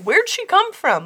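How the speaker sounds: high, loud, puzzled outburst; mock-baffled, pitch jumping up; baffled reaction. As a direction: imitate everyone suddenly bewildered. Acting out everyone's baffled reaction.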